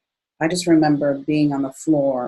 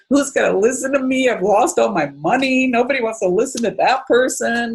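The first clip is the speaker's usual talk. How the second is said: pity party; 8 semitones higher, 4 dB louder than usual. Retold or imitated. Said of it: "having a real pity party".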